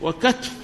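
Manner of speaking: وكتف as "wa-katf", pitched high; short a, no vowel after t in وكتف; third dialect form. Shown as a demonstration. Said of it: "ثلاث لغات في كتف".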